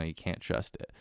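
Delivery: breathy unvoiced aside about fruit; mock confiding whisper. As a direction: lean in and breathe it like a secret.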